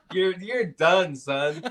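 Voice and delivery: slow, laughing through the words; giggling at himself. Real, not acted. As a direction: chuckle out the line slowly. Laughing at own near-impression.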